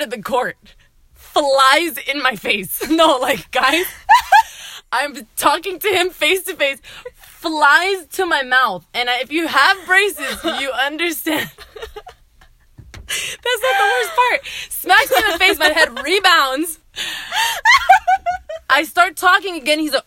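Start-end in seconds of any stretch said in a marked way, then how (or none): 3.9-4.9 shrieking laugh, very high; high burst of laughter. Real, not acted. Girl cracks up telling friends about basketball to the face, shrieking laugh. 16.9-18.6 shrieking cackle, louder; high squealing laugh. Real, not acted. Girl recalling braces cutting her gums, high helpless laughter.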